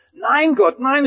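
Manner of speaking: high, loud cry of no; the man protests loudly to the voice from above. Acted. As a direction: shout the denial up to God. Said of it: "rief er laut".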